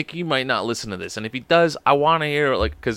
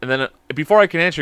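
ordinary talk, nothing marked

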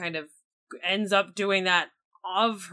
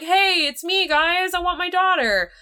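higher and louder breezy greeting; casual Ymir quote; 9 semitones higher, 4 dB louder than usual. Retold or imitated. Ymir strolling into Cintra, breezy higher louder greeting. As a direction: play the dad strolling in, pitched up. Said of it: "just walk in and be like".

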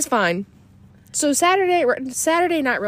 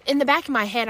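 ordinary delivery

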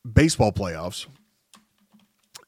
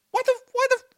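exasperated mock outburst; much faster than usual. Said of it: "and you're going".

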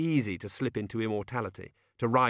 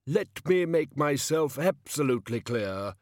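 slow, high, emphatic kingly warning; sharp; 6 semitones higher, 4 dB louder than usual. A narrator's reading. King laying down the law to peasant, sharp. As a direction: spell it out sharply, word by word. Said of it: "said the king sharply".

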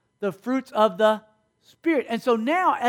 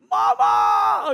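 put-on squeaky baby-bird cry for mama; a high, airy panicked shout; rubbery high squeaky toy; 5 semitones higher, 4 dB louder than usual. Eaglet falling cries for mama.

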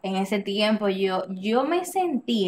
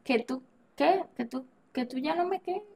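higher-pitched, incredulous echo question; retold disbelief; 5 semitones higher, 5 dB quieter than usual. Girlfriend retelling her reply to being told it's over, incredulous.

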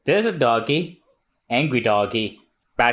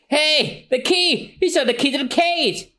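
high, eager exclamations; high-pitched acted excitement; excited discovery. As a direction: burst out at the sight of rescue. Caged hero sees the key.

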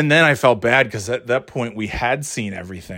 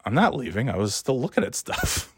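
mock muttered reply; 8 dB quieter than usual. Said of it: "I'm like".

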